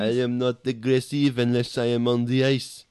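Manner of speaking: put-on French Canadian accent, mouth full of peanut butter; muffled French Canadian accent. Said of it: "sounds like he's deaf and has peanut butter in his mouth".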